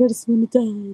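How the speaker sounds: imitating a drunk uncle's crying whine; a hint of drunken sobbing; mock drunk crying. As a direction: whine it like a teary drunk, lightly mocked. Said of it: "he used to just cry".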